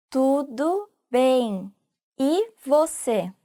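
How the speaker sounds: Tudo bem drawn out very slowly; slow repeat. Shown as a demonstration. Teacher modelling the reply slowly for students. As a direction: stretch out Tudo bem, e você for learners. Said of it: "you can add".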